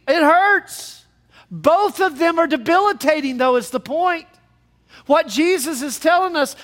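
high, loud, emphatic sermon delivery; full-force preaching. Real, not acted. Preacher telling congregation both speck and beam hurt, full-force preaching. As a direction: raise the voice and hammer the point.

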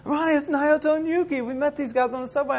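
high-pitched excited call; excited recognition. Retold or imitated. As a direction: tell your friend excitedly who you've just spotted. Excitedly hailing his friend in story.

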